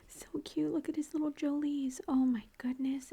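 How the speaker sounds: normal talking voice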